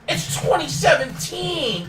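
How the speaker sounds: high, drawn-out protesting shout; exasperated outcry. Real, not acted. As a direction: cry it out slowly in high exasperation. Exasperated rant about aging headliners.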